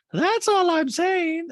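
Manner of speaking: high, loud put-on Diana voice; Diana impression. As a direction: chirp it up high like a prissy socialite. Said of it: "Diana goes".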